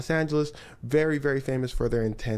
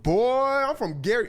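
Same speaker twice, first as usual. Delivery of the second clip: [name]; 8 semitones higher, 4 dB louder than usual. Retold or imitated. Storyteller playing the singer standing up to his people, mock-tough impersonation. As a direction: stretch out the opening word, loud and high. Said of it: "[name] voice can get pretty deep".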